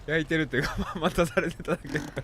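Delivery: laughing. Real, not acted. Laughing while explaining jealousy.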